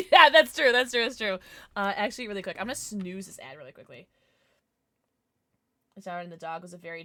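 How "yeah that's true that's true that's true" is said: loud laughing agreement. Real, not acted.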